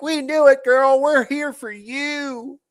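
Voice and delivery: squealy mock-supportive fangirl; mock Swiftie. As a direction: camp it up as a consoling superfan. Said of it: "all the fucking Swifties are gonna be like".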